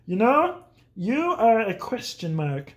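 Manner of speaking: slow, puzzled father impression; imitated dad. Dad not understanding his child, slow and puzzled. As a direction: deliver a baffled dad's odd verdict, slowly. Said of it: "my dad would say this".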